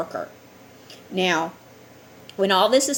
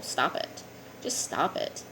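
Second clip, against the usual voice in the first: hushed scolding; 4 semitones lower, 8 dB quieter, slower than usual. Real, not acted. Scolding greed.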